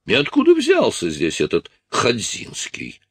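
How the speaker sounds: lightly voiced high, puzzled indignation; a hint of a high-voiced, suspicious officer; indignant Polish officer. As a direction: raise the pitch, mildly affronted. Strus puzzles indignantly over the stranger.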